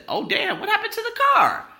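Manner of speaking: mock shock shooting up high; shocked neighbor. Neighbor seeing the wrecked car, shocked. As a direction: imitate startled disbelief, pitch leaping.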